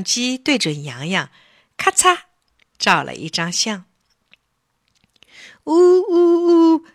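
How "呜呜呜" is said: slow, high, acted little sob; drawn-out childish whimper; crying. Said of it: "是谁在小声哭啊".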